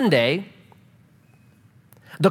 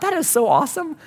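delighted; 9 semitones higher than usual. Retold or imitated. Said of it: "I just thought".

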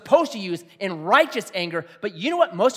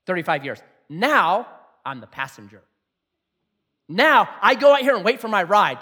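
normal talking voice

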